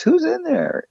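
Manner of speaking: mock alarm, voice jumping up; alarmed question. Team leader startled by the stranger's reply, mock alarmed. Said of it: "And she goes".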